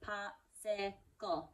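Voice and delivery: Popsicle slow, each syllable apart; clapped out syllables. Shown as a demonstration. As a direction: clap Popsicle out slowly. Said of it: "How many?".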